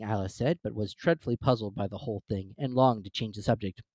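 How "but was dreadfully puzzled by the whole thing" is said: ordinary delivery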